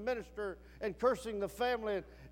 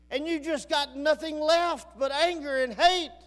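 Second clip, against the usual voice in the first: rising preacher call; 5 semitones higher, 5 dB louder than usual. Acted. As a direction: climb higher and louder into the plea. Preacher calls listeners to God.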